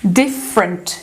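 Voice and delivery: "different" low, in two beats, dif-frent; dropped middle E. Shown as a demonstration. Teacher telling learners to imagine no E, reduced "different". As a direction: say "different" as if the first E weren't there. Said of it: "essa palavra é escrita assim".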